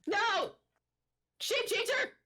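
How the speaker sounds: high, frantic gnome shrieking in terror; panicked gnome. Acted. DM voices terrified gnome again.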